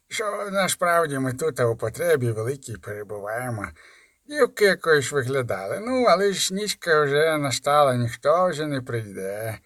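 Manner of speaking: lightly voiced sinister thug; sinister lisping robber. A narrator's reading. Lisping robber threatening boy.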